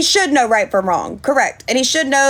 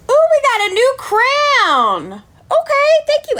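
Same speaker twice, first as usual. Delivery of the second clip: excited squeal; 12 semitones higher than usual. Real, not acted. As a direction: sing out high, thrilled at the gift. Thanks for a gift on stream.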